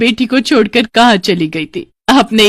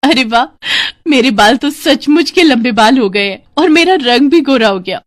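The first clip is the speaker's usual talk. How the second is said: put-on higher, louder, amazed girl; delighted surprise; 6 semitones higher, 4 dB louder than usual. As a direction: marvel at your own transformation. Girl discovers magic long hair. (खुशी भरी हैरानी)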